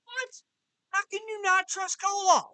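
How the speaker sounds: real loud shocked outburst; shocked disbelief. Real, not acted. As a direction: protest as if it's unthinkable. Host reacts in disbelief.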